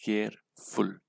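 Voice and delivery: केयरफुल broken in two, केयर, then फुल; split into syllables. Shown as a demonstration. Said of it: "कितने syllable का use करना है".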